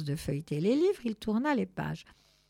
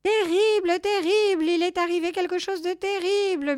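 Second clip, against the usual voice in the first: very high, loud meowed lament; high-pitched alarm, dramatically overplayed; distraught wailing cat; 11 semitones higher, 7 dB louder than usual. A narrator's reading. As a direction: wail it like a panicking cat. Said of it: "miaula Je sais tout en les voyant".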